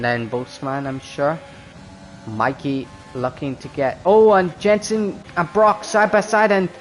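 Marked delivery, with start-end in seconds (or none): 4.0-6.7 excited live race call; motorsport commentator. Acted.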